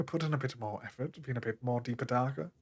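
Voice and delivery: soft, low British voice. Shown as a demonstration. Showing a friend how he flusters people, soft dark British voice. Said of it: "doing the British accent".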